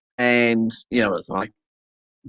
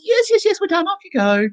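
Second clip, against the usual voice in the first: high brisk mock voice; beautician. Beautician sending the client off, high mock voice. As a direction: shoo him off up high.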